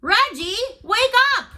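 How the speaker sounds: high, loud wake-up call; calling to wake him. Acted. Boy calling his sleeping friend to wake up.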